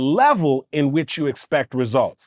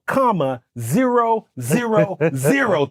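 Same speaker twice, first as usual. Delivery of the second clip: ordinary delivery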